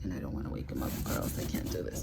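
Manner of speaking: whispered, fast; quiet at night. Real, not acted. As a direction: hush it so he won't wake. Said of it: "my son is asleep".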